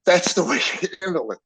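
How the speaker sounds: high, choked-up insistence; real emotional conviction. Real, not acted. Father praising his daughter-in-law's response, choked up. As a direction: press the words out through rising emotion. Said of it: "I'm gonna get emotional".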